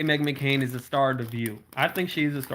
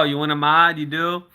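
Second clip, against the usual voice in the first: usual speaking voice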